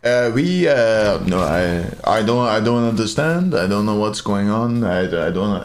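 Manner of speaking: halting French-accented English, badly pretending ignorance; mock French accent. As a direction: feign confusion in a French accent. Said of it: "they'll pretend they don't know English".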